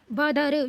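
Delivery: बदर sounded in separate syllables, no n; reading without nasal. Shown as a demonstration. Teacher showing how not to read it, no nasal. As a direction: spell out बदर slowly without any nasal. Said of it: "यो नहीं पढ़ेंगे".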